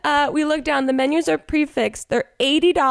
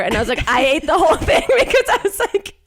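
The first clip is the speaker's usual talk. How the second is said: laughing; 7 semitones higher, faster than usual. Real, not acted. Laughing, exasperated story.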